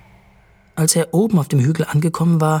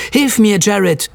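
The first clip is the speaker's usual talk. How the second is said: higher, louder call for help; 5 semitones higher, 5 dB louder, slower than usual. A narrator's reading. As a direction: call urgently, without a full shout. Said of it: "rief Jared in der Hoffnung". (erhobener Hilferuf, leicht gespielt)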